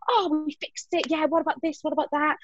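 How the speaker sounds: high, light burst of ideas; put-on keen brainstorming; mock relieved eagerness. Acting out sudden relief and ideas.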